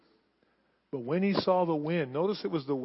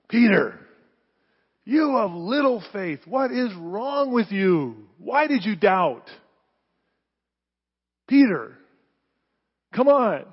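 loud, higher, exasperated scolding; scolding rebuke; 6 semitones higher, 7 dB louder, slower than usual. Shown as a demonstration. Jesus in the water rebuking Peter, loud and exasperated. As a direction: scold him like a frustrated parent. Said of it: "What was his tone of voice?".

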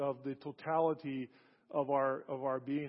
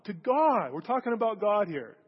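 higher and louder, driving it home; emphatic preaching; 4 semitones higher, 5 dB louder than usual. Acted. Preacher insisting to the congregation, louder. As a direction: lift it and insist on God.

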